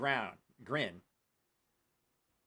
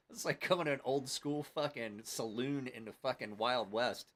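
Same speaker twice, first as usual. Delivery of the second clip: ordinary talk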